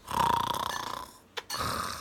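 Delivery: breathy whispered last word. A narrator's reading. Reading to a child, ending the refrain in a whisper. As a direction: let the word fade into a whisper.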